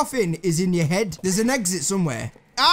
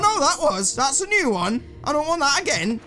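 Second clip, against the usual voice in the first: ordinary delivery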